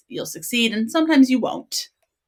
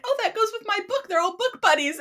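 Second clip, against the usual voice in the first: much higher, bubbly excitement; gushing thought. Retold or imitated. Reader gushing about matching books, squealing high. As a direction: squeal it like a delighted fan.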